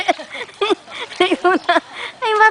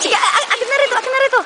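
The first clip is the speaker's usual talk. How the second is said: excited; 9 semitones higher, much faster than usual. Real, not acted. Eager to take the baby.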